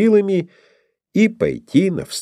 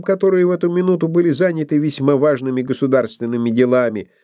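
plain reading voice

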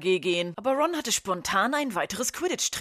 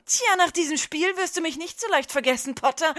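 sneering rival; 9 semitones higher than usual. Acted. Draco taunting Harry during the match, high sneer. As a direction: needle him from above.

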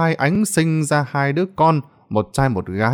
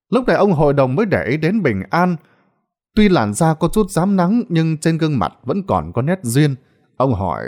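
plain reading voice

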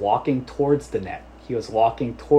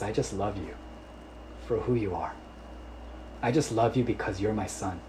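tender father; 7 dB quieter than usual. Acted. Voicing loving heavenly father.

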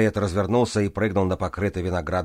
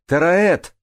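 much higher, louder second call; louder shout; 11 semitones higher, 10 dB louder than usual. A narrator's reading. Джанель calls the missing Тараэт a second time, shouting higher and louder. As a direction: shout the name with rising urgency. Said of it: "крикнула Джанель".

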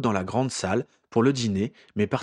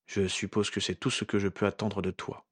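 toneless voice; 7 dB quieter than usual. A narrator's reading. Hurt Ginny answers Harry in a soft toneless voice. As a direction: say it hollow and drained. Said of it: "d'une voix sans timbre".

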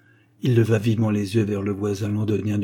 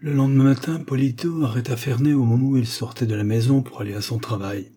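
usual speaking voice

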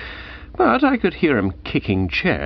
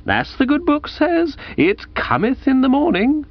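mock preacher. Mock scripture quote.

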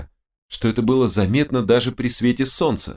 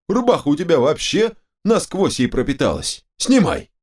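high, excited protesting; agitated squabble; 8 semitones higher than usual. Acted. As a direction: argue in a high voice, all worked up. Squabble over the precious slime. (возбуждённая перебранка высоким голосом)

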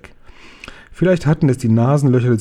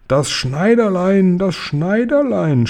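high, slow wailing giant; hammed-up storybook terror; terrified outburst; 7 semitones higher, slower than usual. A narrator's reading. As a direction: draw the dreaded name out in a high howl. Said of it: "stieß der Fleischfetzenfresser gurgelnd hervor".

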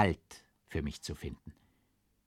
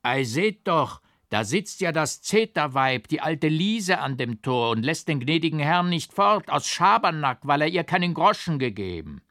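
put-on loud calling old farmer, higher; 6 semitones higher, 6 dB louder than usual. A narrator's reading. Old farmer points out the hag, calling loudly. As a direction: shout it out across the gate. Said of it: "Da rief ein alter Bauer".

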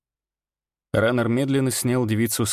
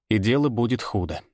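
ordinary delivery, nothing marked

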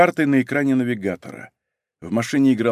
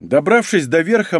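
usual speaking voice

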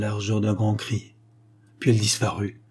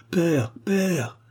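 narrated cry for help, pitched up; 7 semitones higher than usual. A narrator's reading. Princess throws herself on the king, crying out. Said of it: "cria-t-elle".

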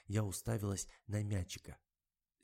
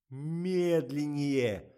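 very drawn-out loud shout; long, high bellow; yelled command; 5 semitones higher, 7 dB louder, much slower than usual. A narrator's reading. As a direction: holler it, stretching the word right out. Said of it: "заорал Коля".

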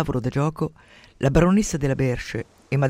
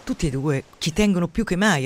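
ordinary delivery

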